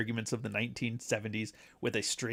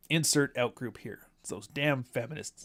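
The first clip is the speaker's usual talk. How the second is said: ordinary talk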